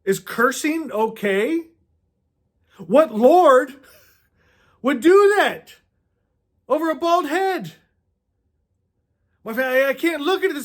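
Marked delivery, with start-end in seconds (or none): 2.8-7.7 louder and rising, each phrase higher; indignant outcry. Real, not acted. Preacher challenges the congregation over the bears story, rising indignation. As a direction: demand it of the room in disbelief.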